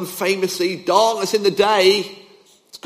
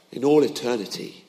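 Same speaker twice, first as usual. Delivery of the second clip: usual speaking voice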